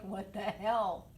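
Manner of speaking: slow, dragged-out mock disbelief; cat's inner voice. Owner voicing her squished-face pet, slow mock disbelief. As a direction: voice the cat, baffled and put out. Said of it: "She's like".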